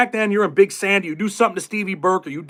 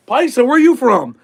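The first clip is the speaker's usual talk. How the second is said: slow, raised, loud put-on greeting; mock paisa greeting; 6 semitones higher, 5 dB louder, slower than usual. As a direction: hail a fellow inmate, hamming it up. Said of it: "talking about their paisas".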